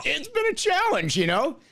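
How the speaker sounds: lightly hammed-up high-pitched referee quote; retelling a fellow referee's line in a high teasing voice; mock referee voice. Olympic referee quote continues.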